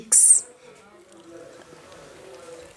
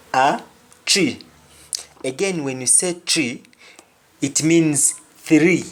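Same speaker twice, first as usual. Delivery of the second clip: ordinary delivery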